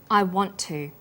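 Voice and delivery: "want to" linked with the t held slightly longer; "want to" sharing one t sound; consonant linking. Shown as a demonstration. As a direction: hold the t of "want" on the tongue, then say "to". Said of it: "is held slightly longer on the tongue".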